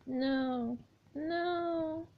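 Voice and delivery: high, soft eerie wail; anguished ghost girl. Acted. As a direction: wail no like a tormented spirit. Jane howling refusal.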